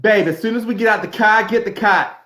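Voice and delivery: a hammed-up Southern twang; Southern accent. Shown as a demonstration. Showing off his Southern accent to friends. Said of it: "here's mine".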